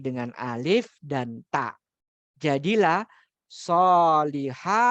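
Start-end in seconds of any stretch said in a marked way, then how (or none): 2.3-4.8 slow, louder, careful solihatun with each syllable drawn out; teacher modelling solihatun slowly and loudly; drawn-out model word. Shown as a demonstration. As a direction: draw out solihatun for the class.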